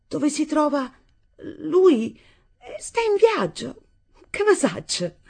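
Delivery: high-pitched, hesitant, confused answer; confused, flustered reply. A narrator's reading. Daughter confused by mother's aggressive questions, flustered. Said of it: "mormorò Nunz confusa a quei modi aggressivi".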